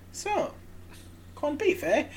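high mocking question about the corned beef; smug hero quip. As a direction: taunt the villain sweetly. Eris confronts villain.

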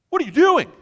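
very high, loud shriek of disbelief; aghast outburst. Acted. Friend watching stacks of cash burn, aghast shout. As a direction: explode in horrified disbelief. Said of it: "you're all sitting there aghast".

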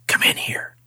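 eerie whispered invitation; hushed, creepy beckoning; ghostly voice. Retold or imitated. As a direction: whisper it like a lurking spirit. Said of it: "and it says".

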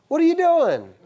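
high, loud astonished question; amazed sailors. Retold or imitated. Sailor rousing sleeping Jonah, high incredulous cry. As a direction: sailors shocked to find Jonah asleep. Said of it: "what's their first comment".

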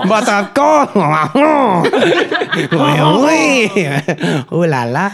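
hammed-up high French accent; over-the-top French charm. Mocking French speech.

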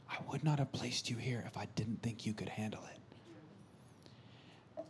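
slow, breathy whisper; soft, deliberate whispered encouragement; hushed heavenly voice. Retold or imitated. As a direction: whisper it as God to a child. Voices heavenly Father in spirit.

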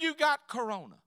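high, loud, insistent repeat; exasperated son, loud and high; exasperated shout. Acted. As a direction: raise your voice to get it through to her.

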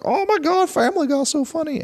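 high gushing mock fan. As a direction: squeal it like an overexcited fan. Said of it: "I had that moment in my life where it's like".

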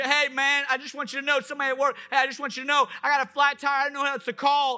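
lightly acted high, pleading caller; hesitant mock plea for help over the phone; stranded caller. Coworker with a flat tire asking help, lightly acted pleading.

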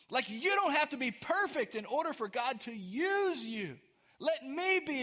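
high, ringing proclamation to the congregation; preaching at full force. Real, not acted. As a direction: proclaim the good news with fervour. Proclaims good news.

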